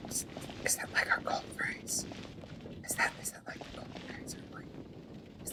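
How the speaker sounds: whisper. Real, not acted. Musing to himself about the call phrase.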